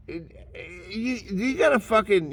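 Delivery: high, choked and crying; words catching in a sob; real tearful break. Real, not acted. Voice breaks mid-rant.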